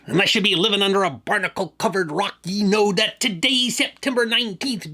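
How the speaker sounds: gruff swaggering pirate. Acted. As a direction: growl it like an old sea dog. Pirate day intro in character.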